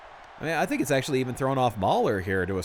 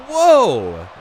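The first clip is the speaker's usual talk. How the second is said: huge loud whoa; shout; 6 semitones higher, 12 dB louder than usual. Real, not acted. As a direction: yell in amazement. Huge moonsault lands.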